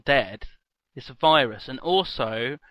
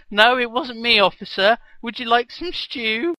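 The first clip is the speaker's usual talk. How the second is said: innocent act; 10 semitones higher, 6 dB louder than usual. Retold or imitated. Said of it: "And you say".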